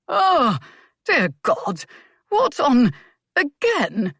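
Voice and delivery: high, loud dismayed outcry; the Earl throwing up his hands in horror; appalled old Earl catching his daughter in a man's lap; shocked father. Acted.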